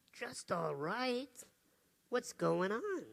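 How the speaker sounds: soft, slow and caring; a soft-spoken adult soothing a sad child; gentle concern. Retold or imitated. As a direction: ease the worry out of her. Speaker gently asks upset child.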